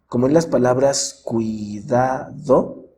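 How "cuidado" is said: cuidado slowly split as cui-da-do; syllable by syllable. Shown as a demonstration. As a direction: keep "cui" as one syllable in cuidado, then pause between the rest. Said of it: "pueden pronunciarse en la misma sílaba".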